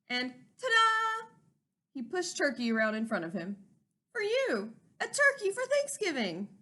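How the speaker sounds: Mister Moose showing Turkey to his wife; proud reveal. Acted. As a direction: present it like a magic trick.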